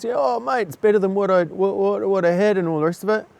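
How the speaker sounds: chirpy no-worries mate voice; cheery bloke. Retold or imitated. Amputee stranger answering in the queue, cheery and breezy. Voicing the amputee man's reply.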